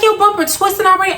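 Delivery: high, quick incredulous question; mock disbelief. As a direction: tease her about the bumper. Mocking the twisted bumper.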